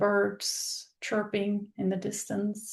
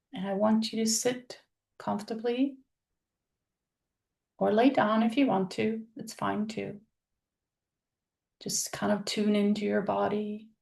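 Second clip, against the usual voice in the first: normal talking voice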